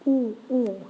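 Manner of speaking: "ooh" with air puffed in the cheeks; rounded French ou. Shown as a demonstration. Teacher models the cheek-filled sound. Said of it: "air inside your cheeks".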